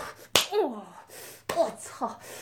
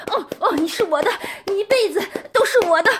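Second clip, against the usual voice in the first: breathless high vow at climax; climactic cry; 7 semitones higher than usual. Acted. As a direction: shout the claim at the peak.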